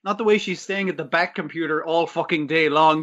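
usual speaking voice

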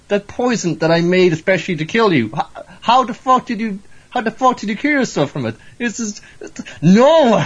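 high-pitched mock outrage building to a loud "No!"; having conniptions. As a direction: splutter in disbelief like a thwarted poisoner. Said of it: "having conniptions".

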